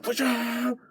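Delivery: high yelled warning; sudden high-pitched yell; mock battle cry. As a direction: shout it like a charging fighter. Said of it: "he's like".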